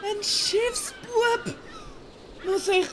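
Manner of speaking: high, slow, appalled question; can't believe he's demoted; shocked, whiny disbelief; dismayed surprise. Acted. Dismayed at being a cabin boy.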